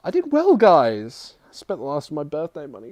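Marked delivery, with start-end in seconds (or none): 0.0-0.6 high proud exclaim on well; excited. Real, not acted. 0.6-1.3 excited. Real, not acted. Proud of his purchase.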